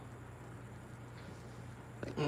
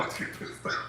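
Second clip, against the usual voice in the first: soft unvoiced chuckle; quiet breathy laugh; 15 dB quieter than usual. Real, not acted. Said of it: "Let me calm down".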